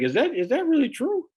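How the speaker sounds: high-pitched mock doubt; imitating a sceptical podcast host; Joe Rogan. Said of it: "Or he'll say, like".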